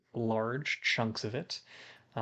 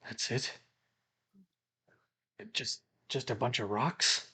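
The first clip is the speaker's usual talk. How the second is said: understated, halting disbelief; let-down disbelief; 3 dB quieter than usual. Acted. Borgin eyeing the stash he was handed, let-down disbelief. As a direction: question it softly at first, hesitating. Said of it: "he goes".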